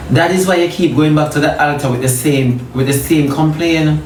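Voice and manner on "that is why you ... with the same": ordinary talk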